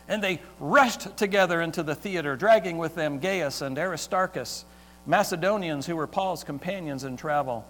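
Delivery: ordinary talk, nothing marked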